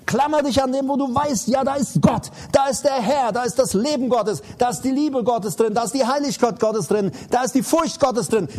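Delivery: loud, high, urgent sermon voice; preaching at full force. Acted. Preacher urging his congregation to flee sin, full-force preaching. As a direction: drive the warning home from the pulpit.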